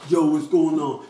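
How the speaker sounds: loud, hammed-up street slang voice.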